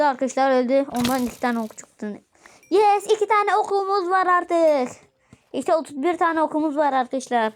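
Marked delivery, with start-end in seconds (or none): 2.7-5.0 high, slow happy cheer; excited yes. Real, not acted. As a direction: cheer it out slowly. Happy about getting arrows.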